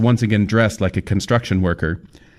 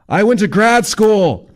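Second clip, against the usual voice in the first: put-on indignant young man; 13 semitones higher, 5 dB louder, slower than usual. A narrator's reading. Kyle's indignant reply.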